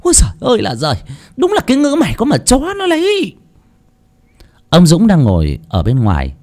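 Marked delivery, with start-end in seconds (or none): 0.0-3.3 scolding yell. Acted. Mother Huyền scolding Hồng over the packed suitcases, shouting. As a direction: yell at her like a fed-up mother. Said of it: "mà quát lên".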